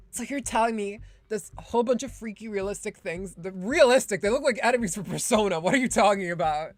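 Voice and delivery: loud, incredulous challenge; indignant disbelief. Acted. Guest challenging staff about the realistic holograms, indignant. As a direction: build to a loud, exasperated demand.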